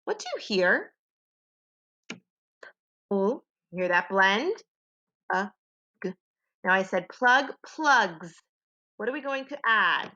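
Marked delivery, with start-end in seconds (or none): 2.1-3.4 "P-L" as isolated sounds, p then ull; sounded out. Shown as a demonstration. Teacher asks what children hear, sounded out. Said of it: "So let's tap it out". 5.3-6.1 "U-G" as separate sounds, uh then g; sounded out. Shown as a demonstration. Teacher finishes tapping out plug, sounded out. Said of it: "So let's tap it out".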